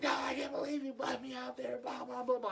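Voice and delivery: mock high-pitched tirade, not at full volume; high, whiny mock complaint; imitated angry yelling. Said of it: "he's cussing and yelling at us".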